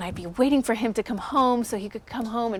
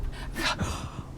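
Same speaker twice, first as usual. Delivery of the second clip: mock gasp; 8 dB quieter than usual. Child reacting to mom's advice.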